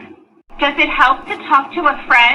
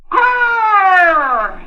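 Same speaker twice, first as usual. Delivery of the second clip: angry growl; 5 semitones higher than usual. Acted. Dana growls in anger.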